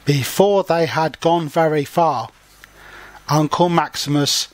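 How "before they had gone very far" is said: usual speaking voice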